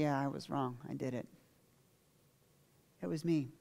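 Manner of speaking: soft, hushed admission of guilt; quiet confession. Acted. David admits his sin before God, quiet confession.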